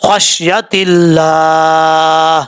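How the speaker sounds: slow خشیت اللہ, the ہا silent at the stop; stop reading. Shown as a demonstration. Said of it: "اس طریقے سے پڑھنا ہے".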